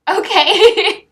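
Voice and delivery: amused laugh on okay. Real, not acted. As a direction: laugh it out in disbelief. Laughing at a harsh comment.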